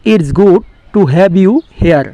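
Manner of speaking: very slow, careful English; slow model sentence. Shown as a demonstration. Teacher giving the English translation, slow and deliberate. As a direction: lay each word out slowly. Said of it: "এর English হবে".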